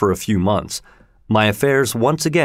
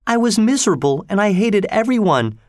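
tearful confession; 9 semitones higher than usual. A narrator's reading. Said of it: "drying her eyes".

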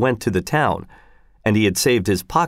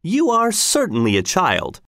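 mild snap; 8 semitones higher than usual. Acted. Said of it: "snapped Hedwig".

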